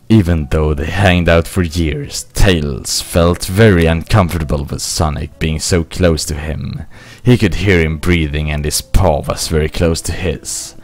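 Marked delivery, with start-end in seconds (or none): none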